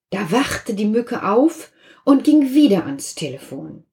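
usual speaking voice

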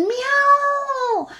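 very high meowing cat; high cat cry for the children. Acted. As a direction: mew it like a cat. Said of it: "Und die Katzen riefen".

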